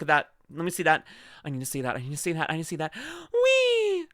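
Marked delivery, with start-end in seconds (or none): 3.3-4.1 sharp gasp into a high squeal; gasp, then squeal high. Real, not acted. Streamer reacting to a bikini picture with a high squeal.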